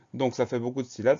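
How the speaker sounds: ordinary delivery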